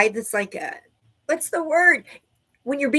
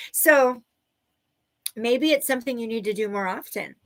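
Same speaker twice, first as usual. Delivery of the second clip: ordinary talk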